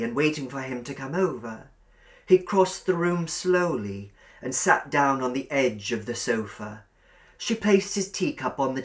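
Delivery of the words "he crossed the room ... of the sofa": ordinary delivery, nothing marked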